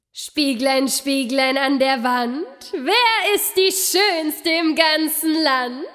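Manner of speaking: lightly voiced queen, rising question; vain queen. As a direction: ask the mirror with vain longing. Queen asks mirror. (eitle Königin, fragend)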